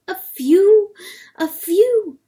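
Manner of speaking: high, loud, indignant echo; incredulous. A narrator's reading. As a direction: throw the words back high and loud. Hen challenges his excuse.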